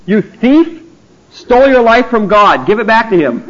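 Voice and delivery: mock accusation. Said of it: "you walk up to the guy and go".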